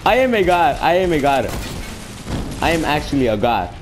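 loud, high victory cry that settles down; triumphant boast. Real, not acted. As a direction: crow over the shot, cooling each time. Bragging after lucky shot.

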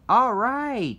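high, loud triumphant cheer. A narrator's reading. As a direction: shout it out in triumph. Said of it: "cheered Savannah".

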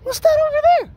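high loud alarmed shout. Real, not acted. Spotting something alarming.

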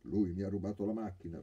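a touch of a bruised man whimpering; whimpering complaint. A narrator's reading. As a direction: whine it like a wronged child. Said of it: "piagnucolò il primo cieco".

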